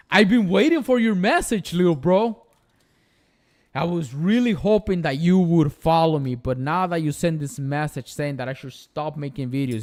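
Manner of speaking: loud exaggerated sarcasm aimed at a kid hater; mock sweet sarcasm. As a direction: gush over the hater's message in mock delight.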